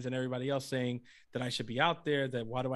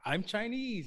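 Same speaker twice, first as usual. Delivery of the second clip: lightly mimicked comedian's Chinese accent, higher pitch; imitated Chinese accent; 7 semitones higher, slower than usual. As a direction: retell a skit's Chinese accent bit. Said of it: "and he did the accent".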